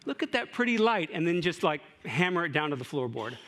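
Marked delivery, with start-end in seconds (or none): 0.0-1.1 higher, airy oblivious delight; a preacher mocking a careless driver, mock delight. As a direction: coo at the light like it's pretty. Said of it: "the worst thing you can do is go".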